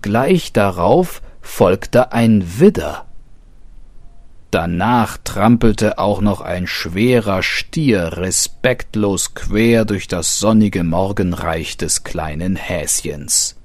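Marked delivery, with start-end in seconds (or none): none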